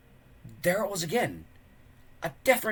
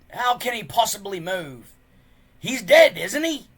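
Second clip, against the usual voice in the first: scoffing Gobber; 8 semitones higher, 10 dB louder than usual. A narrator's reading.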